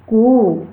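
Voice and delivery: कू stretched out like a rooster's crow; prolonged pluta vowel. Shown as a demonstration. Teacher repeats the rooster's crow to show vowel length. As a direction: draw कू out longest of all. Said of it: "कि पहले कम होती है".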